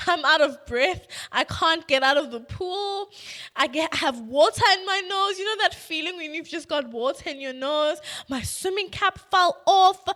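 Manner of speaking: loud, high, breathless-sounding comic rant; mock panic. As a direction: pile up complaints in hammed-up distress. Replays panicked tryout failure, comically exaggerated.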